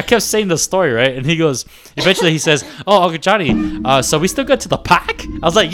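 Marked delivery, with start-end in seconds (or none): 2.9-5.2 "park" said as "pahk", no R; Boston accent. Retold or imitated. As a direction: kid doing Boston accent. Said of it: "they got a Bostonian accent".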